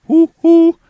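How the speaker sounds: very high, loud cartoon hoot; owl hoot. Shown as a demonstration. Streamer trying an owl call for chat, loud hooting. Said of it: "an owl doesn't sound like that".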